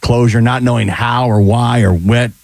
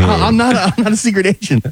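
high, flustered denial; mock movie character; 9 semitones higher than usual. Retelling a comedy scene, high flustered denial. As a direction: squeak out the lie like a film character. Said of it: "he's like".

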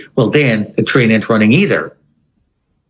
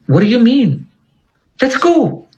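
urgent urging; 12 semitones higher than usual. Retold or imitated. Albert urging him to leave.

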